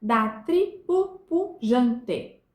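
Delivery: very slow "Da tribo pujante", each syllable apart; "pujante" split into pu, jan, te; syllable-by-syllable scansion. Shown as a demonstration. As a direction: scan "Da tribo pujante" beat by beat. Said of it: "vamos pro Juca Pirama".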